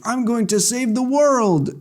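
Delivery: high, grand put-on voice; hammed-up brave boast; mock heroic. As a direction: declare it like a cartoon hero. Voicing a would-be hero.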